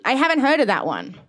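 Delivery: ordinary delivery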